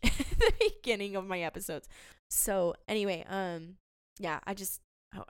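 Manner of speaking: usual speaking voice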